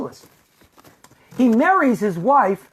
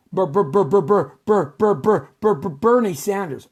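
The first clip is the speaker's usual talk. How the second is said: rapid repeated first syllable before the name; mock stutter. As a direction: stammer "Ber-" over and over, then finish it.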